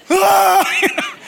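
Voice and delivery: high, loud demonic scream; demon shriek. Acted. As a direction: screech as the demon. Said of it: "Here's this demon guy".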